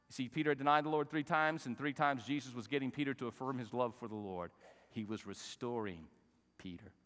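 normal talking voice

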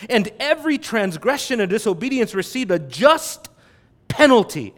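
'just' in "a just penalty" leaned on, said clearly; stress on just. Shown as a demonstration. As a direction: press on the word 'just' before penalty. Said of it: "emphasis on the word just".

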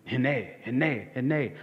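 hene said as hi-nay, stressed first syllable; Hebrew hene. Shown as a demonstration. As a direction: repeat hene three times like the Hebrew. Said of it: "Hebrew word, hene".

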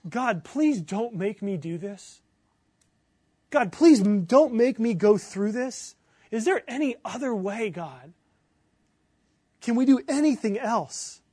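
pleading with God, slow and insistent; impassioned plea, leaning hard on key words; earnest anguished prayer. Acted. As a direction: beg urgently for any other way out. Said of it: "prayed even more earnestly".

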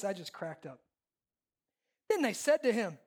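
usual speaking voice

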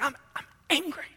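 high-pitched stammered protest; hammed-up sulk; mock sulky anger. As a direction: play a petulant Jonah insisting on it. Jonah repeats his angry answer.

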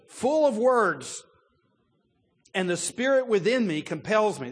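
swelling like he's about to overflow; fired up. Retold or imitated. The preacher voicing Elihu, loud and fired up. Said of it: "this guy's all fired up".

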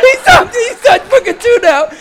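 very high, loud, laughing uproar; hectic comic retelling. Retold or imitated. [name] telling of Lloyd panicking too, hectic and laughing. Shrieking chaos of retold panic.

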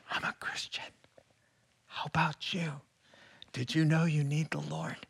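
timid hushed mock evangelism; mock whisper. Preacher mocking fearful Christians, whispering. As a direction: whisper it like you're scared to be heard.

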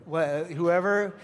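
ordinary talk

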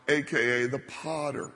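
usual speaking voice

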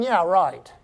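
low, laughing "yeah, right"; dry laughing dismissal; sarcastic scoff. Real, not acted. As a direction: scoff at the very idea with a chuckle. Scoffing at bossing his wife.

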